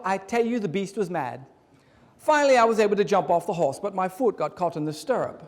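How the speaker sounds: mock British storytelling voice; put-on British accent. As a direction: tell it like an Englishman fumbling a Cajun joke. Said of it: "How was my British accent?".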